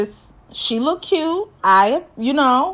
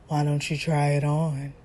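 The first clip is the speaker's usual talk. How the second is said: low, soft come-on; mock seductive; 6 semitones lower, 9 dB quieter than usual. Man offering his date lingerie, mock seductive. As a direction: imitate a suggestive, hushed invitation.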